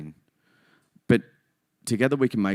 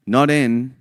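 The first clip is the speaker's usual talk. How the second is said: not N, with N said higher and louder to set it apart from M; stress on N; 4 semitones higher, 8 dB louder than usual. Shown as a demonstration. Host spelling the web address, stressing N. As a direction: punch the N to rule it out. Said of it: "V P M".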